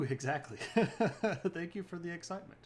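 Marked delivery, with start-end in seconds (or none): 0.6-1.5 quick, high chuckle; short loud burst of laughter; amused laugh. Real, not acted. Amused by the chat's excitement.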